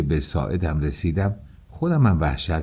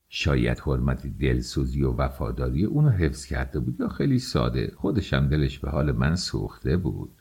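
ordinary delivery

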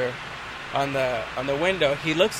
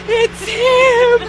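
loud high squeal giving him up; panicked shout; 10 dB louder than usual. Retold or imitated. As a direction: shriek out the culprit in panic. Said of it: "we're like".

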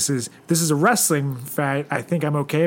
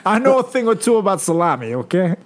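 higher, loud Italian pizza guy; pizza man voice; 7 semitones higher, 3 dB louder than usual. Acted. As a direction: hawk it like a pizza parlor owner. Voicing Tony the pizza man.

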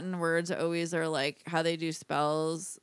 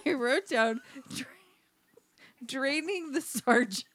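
laughing; 4 semitones higher than usual. Real, not acted. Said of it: "laughing to myself about it".

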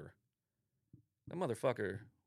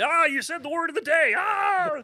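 high, loud game show host shout; game show announcer; 11 dB louder than usual. Acted. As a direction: announce it like a prize buzzer. Word of the day gag.

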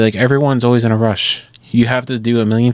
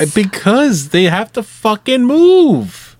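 angry rant; 11 semitones higher, 4 dB louder, slower than usual. Real, not acted. Ranting about slow drivers.

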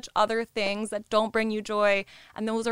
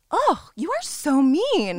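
high, slow, shocked mom; a mother gasping at cruelty; mom's shocked voice; 6 semitones higher, 6 dB louder, slower than usual. Retold or imitated. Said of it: "And she was like".

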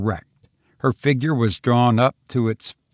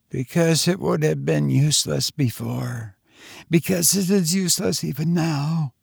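passionate despair; 5 semitones higher than usual. A narrator's reading. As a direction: plead with hopeless passion. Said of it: "clasping her hands passionately".

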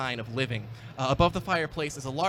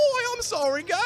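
high, loud, put-on bad accent; an over-the-top street urchin voice, much higher; bad orphan accent; 5 dB louder than usual. Groundskeeper apologizing to the wardens in a bad accent. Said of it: "really bad accent that'll upset a lot of people".

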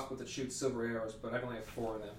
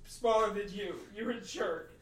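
put-on high, kid-like schoolyard sneer; childish taunt; 9 semitones higher than usual. Bard mocks the were-rat in a high, bratty child's voice. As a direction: whine it up high like a bratty kid mocking a bully. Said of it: "I say".

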